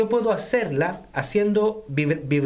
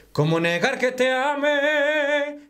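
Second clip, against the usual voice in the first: higher, slow sung line in head voice; 6 semitones higher, slower than usual. Shown as a demonstration. Coach sings the phrase in head voice to contrast it with chest voice. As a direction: float the whole phrase in head voice. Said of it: "Eso es voz de cabeza, no es voz de pecho".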